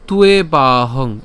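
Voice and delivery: "তুয়ে বাহং" slowly, tu-e baa-hong; broken-down pronunciation. Shown as a demonstration. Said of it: "ভেঙে উচ্চারণ করি".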